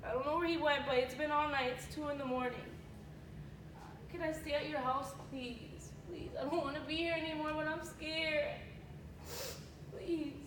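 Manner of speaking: hushed, frightened plea for help; soft scared girlfriend on the phone; scared and pleading. Acted. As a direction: beg quietly to be taken in.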